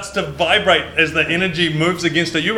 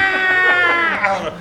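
hand screaming. Acted. The hand creature screams at the players, high scream. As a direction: let out a piercing cartoon scream. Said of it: "and screams".

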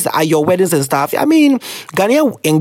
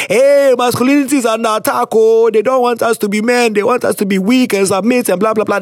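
mocking men's complaint; 4 semitones higher than usual. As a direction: parody complaining men, whining.

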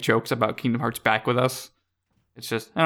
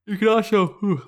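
words stretched by a yawn; 8 semitones higher than usual. Real, not acted. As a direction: speak through a yawn. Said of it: "let me yawn first".

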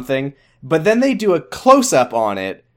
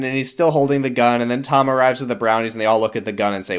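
ordinary talk

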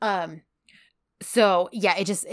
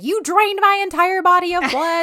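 higher, louder hammed-up accusation; mock outrage; 11 semitones higher, 7 dB louder than usual. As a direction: play it up as a dramatic complaint. Mock outrage retelling heroine.

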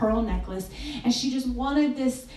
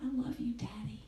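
put-on softer, whispered child's words; tearful whisper; 11 dB quieter than usual. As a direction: whisper it through tears. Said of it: "she said".

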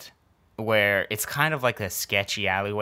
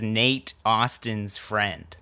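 each word drawn out slowly; slow reading; much slower than usual. Retold or imitated. Reading out the Post-it note.

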